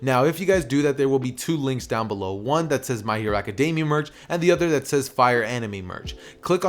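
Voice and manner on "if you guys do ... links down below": ordinary delivery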